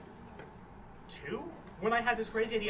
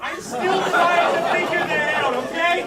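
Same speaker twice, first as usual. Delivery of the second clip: loud, high-pitched comic protest; defensive shout; 7 semitones higher, 12 dB louder than usual. Real, not acted. Teased student defends getting into college, shouting defensively. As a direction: fire back loudly over the laughter. Defensive retort to audience teasing.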